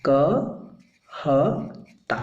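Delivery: कहता stretched very slowly, each syllable clear; drawn-out demo. Shown as a demonstration. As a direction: draw out कहता slowly for the class. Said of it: "अब इसका मतलब है".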